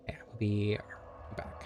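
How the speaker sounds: soft unvoiced whisper. Real, not acted. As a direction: breathe the words, no voice. Streamer stepping away briefly.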